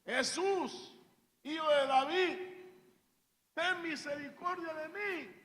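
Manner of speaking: loud, high cry for mercy; gritando. Acted. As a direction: call out as the blind man begging Jesus. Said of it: "comenzó a gritar". (grito suplicante, fuerte y agudo)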